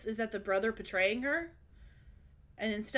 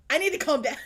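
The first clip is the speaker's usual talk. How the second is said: louder, higher mock outburst; TikTok meme imitation; 5 semitones higher, 7 dB louder, faster than usual. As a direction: lightly mimic a worked-up little girl. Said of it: "This is where I need that TikTok meme".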